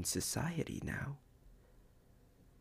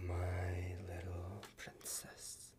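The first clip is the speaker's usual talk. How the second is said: whisper; 3 semitones lower, 9 dB quieter, slower than usual. Acted. Tender pet name to lover.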